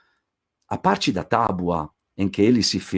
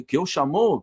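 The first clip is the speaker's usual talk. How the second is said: usual speaking voice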